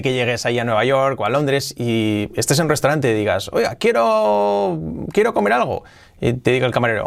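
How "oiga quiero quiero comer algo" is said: cliente imitado. Retold or imitated. Cliente imitado pidiendo en restaurante.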